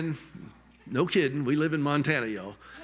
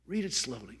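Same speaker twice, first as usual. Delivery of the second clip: "Read" said softly, much quieter the second time; softer repeat; 3 semitones lower, 7 dB quieter than usual. Shown as a demonstration. Urging the audience again, softly and much quieter. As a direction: drop the voice and ease into it.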